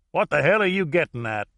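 coarse Louisiana American. A narrator's reading. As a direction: demand it like a coarse Louisiana man. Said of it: "coarse American from Louisiana".

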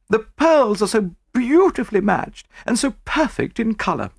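boasting breathlessly of her pearls; gushing rich lady. A narrator's reading. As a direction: Mrs Oppelson eager to show off, high and gushing. Mrs Oppelson wants to fetch pearls.

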